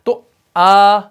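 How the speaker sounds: آ لمبی اور زور سے; loud vowel aa demo. Shown as a demonstration. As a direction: belt out آ as a long aa. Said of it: "اس میں ای کی آواز".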